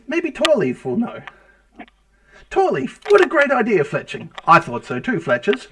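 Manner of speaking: delighted at a shared idea; mutual congratulation. Acted. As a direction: eagerly congratulate a fellow craftsman. Craftsmen delighted with each other's idea.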